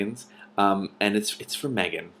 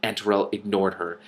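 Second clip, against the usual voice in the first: ordinary delivery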